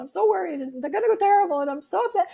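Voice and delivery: high-pitched anxious mock wail; imitated distraught lament. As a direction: retell her tearful worrying in a high voice. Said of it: "crying and lamenting and mourning".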